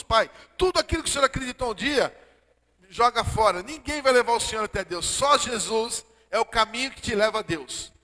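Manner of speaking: put-on urging his father loudly to let go of old beliefs, preaching; full-force preaching. Pastor urging his dying father, full-force preaching.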